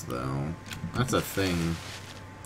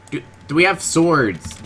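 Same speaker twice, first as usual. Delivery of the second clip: excited call; 9 semitones higher, 6 dB louder than usual. Pretend. Calling to an NPC.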